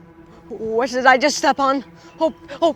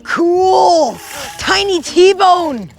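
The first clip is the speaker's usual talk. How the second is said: louder, higher delighted exclaim; excited; 7 semitones higher, 10 dB louder, much slower than usual. Acted. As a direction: burst out with delight. Delighted seeing tiny T-Rex.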